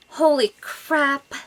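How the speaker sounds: ordinary delivery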